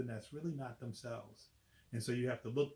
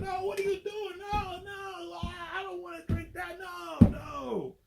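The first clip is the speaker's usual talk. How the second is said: very high, squealing refusal ending in a shout; panicked lizard; 7 dB louder, slower than usual. Acted. Lizard refusing the cure, squealing and shouting no. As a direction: shriek like a cornered monster. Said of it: "He said".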